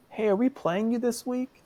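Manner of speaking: high-pitched imitation of a small boy; little kid voice. Eight-year-old asking the rival coach, high little-kid voice. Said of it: "this little eight-year-old kid says".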